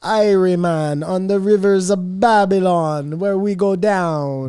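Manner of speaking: hammed-up Jamaican accent. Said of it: "do a Jamaican accent real quick".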